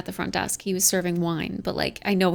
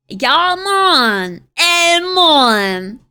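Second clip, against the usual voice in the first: loud, higher, drawn-out vowels; playful put-on voice; 6 semitones higher, 8 dB louder, much slower than usual. Streamer mimics the phrase from chat, playful put-on voice. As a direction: stretch each phrase out in a big silly voice.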